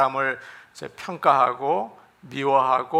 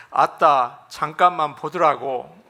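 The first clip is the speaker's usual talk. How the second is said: louder Jeolla dialect line; 5 dB louder than usual. Shown as a demonstration. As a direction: call out in a Jeolla dialect, punching 아따. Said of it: "전라도는".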